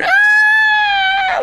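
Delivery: long, very high, loud scream; sustained high-pitched squeal; imitated delighted scream. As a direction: shriek it out in imitation. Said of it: "she screamed".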